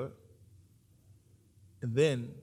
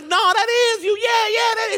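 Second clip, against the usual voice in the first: very high, excited shout; shouted insistence; 11 dB louder than usual. Acted. Crowd insisting to Peter he was with Jesus, shouting. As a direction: holler it over his denial.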